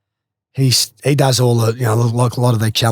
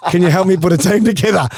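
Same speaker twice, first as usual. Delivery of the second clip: laughing through it; 5 semitones higher than usual. Real, not acted. Laughing while quoting friend.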